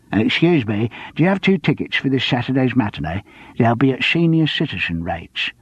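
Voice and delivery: lightly voiced elderly customer; a hint of an old customer; senior citizen customer. Customer at the box office.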